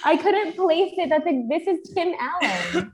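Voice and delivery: high laughing oh my God; laughing surprise. Real, not acted. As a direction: burst out laughing in recognition. Realizing he looks like Tim Allen.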